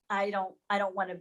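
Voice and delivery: ordinary talk, nothing marked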